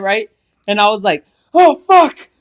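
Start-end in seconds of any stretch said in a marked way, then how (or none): 1.5-2.3 high, loud shocked swear; startled curse. Retold or imitated. Retelling the bite to friends, high loud startled swear. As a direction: blurt it in fright.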